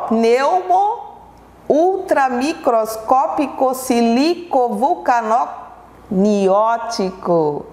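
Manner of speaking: long word sounded out slowly, chunk by chunk; slow, careful read-aloud of a tongue-twisting word. Shown as a demonstration. Teacher reading the giant word for pupils to repeat, slow and careful. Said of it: "Então vamos ler".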